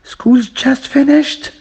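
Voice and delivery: "School's just finished" as a slow question, pitched high and rising to "finished". Shown as a demonstration. Teacher showing learners how to make it a question, "School's just finished" with rising intonation. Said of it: "To make it a question".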